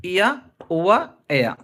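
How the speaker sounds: ఇయా, ఉవా, ఏయా slow and separate, vowels gliding to "aa"; demonstrating diphthongs. Shown as a demonstration. Teacher recapping the three glides for learners, slow demonstration. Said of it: "నేను పలికితే ఈజీగా ఉంటుంది".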